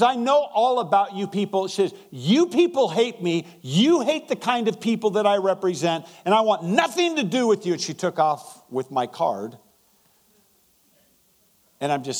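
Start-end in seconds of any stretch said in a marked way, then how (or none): 0.0-7.7 raised, indignant voice, high and a little louder; she's had enough of church folks and lets him have it; angry outburst. Retold or imitated. Woman at the gas pump snapping at the preacher, mildly angry. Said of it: "She came unglued".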